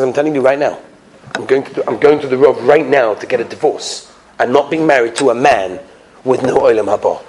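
low, measured and dead serious; acted cold anger, low-pitched; stern ultimatum. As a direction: lay down the law to a husband. Wife repeats divorce threat.

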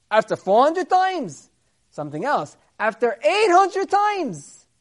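loud, high rising sermon voice; slow, swelling "after eight hundred times"; preaching. Acted. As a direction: build the wonder to a climax. Preacher builds to a peak.

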